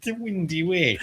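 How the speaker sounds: put-on over the top Irish accent. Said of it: "I'll do my best fake Irish accent".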